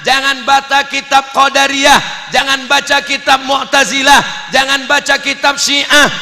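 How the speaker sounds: loud, high, hammered prohibitions; imitating a thundering teacher; kiai's stern warning. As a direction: chant each warning forcefully in the same pattern. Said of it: "Dulu kiai ngatakan".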